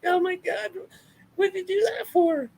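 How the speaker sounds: mock crying whine, very high; exaggerated high-pitched tearful complaint; Becky Lynch. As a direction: imitate a wailing sore loser in falsetto. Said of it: "she was crying, crying, crying".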